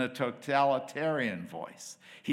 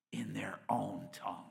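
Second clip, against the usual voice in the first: hushed; 3 semitones lower, 7 dB quieter than usual. Acted. Preacher drops to hushed aside.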